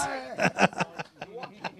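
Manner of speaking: soft, breathless run of laughter. Real, not acted. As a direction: laugh on helplessly at a joke. Laughing at a joke.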